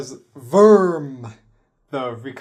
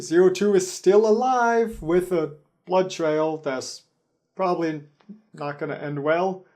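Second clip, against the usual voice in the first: ordinary talk, nothing marked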